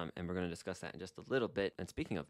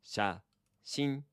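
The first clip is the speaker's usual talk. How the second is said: slow pronunciation; 5 semitones higher than usual. Shown as a demonstration. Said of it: "slowly, if you would".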